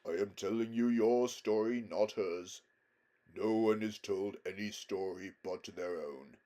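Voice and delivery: solemn, stately lion voice; majestic lion, grave. A narrator's reading. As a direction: speak with calm regal authority. Aslan gently rebukes Aravis.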